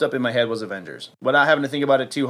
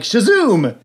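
confident hero shout, louder and higher; mistaken magic shout; 7 semitones higher, 5 dB louder than usual. Retold or imitated. Hero kid shouting the wrong word, mock triumphant. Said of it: "He forgets it's Shazam and he says".

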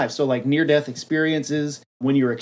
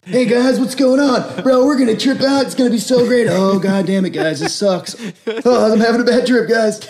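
much higher, louder dopey hippie bro; stoner guru; 8 semitones higher, 5 dB louder than usual. Pretend. Guru greets the heroes, dopey high stoner. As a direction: hippie bro, cheerful then sick. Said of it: "he's always like".

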